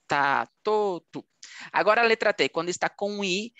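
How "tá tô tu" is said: crisp T as in Spanish: "Tá, tô, tu"; plain T sound. Shown as a demonstration. Teacher shows student T before A, O, U, hard T. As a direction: say "Tá, tô, tu" with a hard T, never CH. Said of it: "igual que o espanhol".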